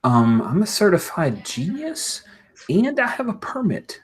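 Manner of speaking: high-pitched smug comeback; lofty, self-important superhero; haughty retort. Acted. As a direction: boast with pitch swooping way up. Shaker boasts back at Hank.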